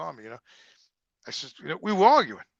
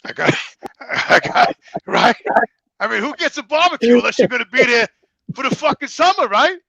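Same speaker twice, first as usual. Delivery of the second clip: real laughter, higher and louder; laughing; 9 semitones higher, 13 dB louder than usual. Real, not acted. Man telling friend about the barbecue gift, laughing. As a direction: laugh through the punchline.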